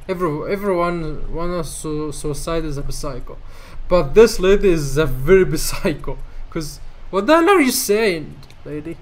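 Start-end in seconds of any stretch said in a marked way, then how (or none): none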